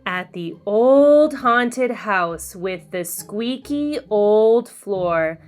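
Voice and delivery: ordinary delivery, nothing marked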